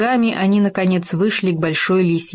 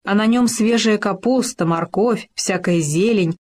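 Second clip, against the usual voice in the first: plain reading voice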